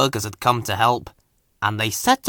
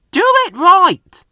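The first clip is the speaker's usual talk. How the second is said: higher, louder firm instruction; firm call; 7 dB louder than usual. A narrator's reading. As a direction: call the rule out firmly. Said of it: "called Fergus".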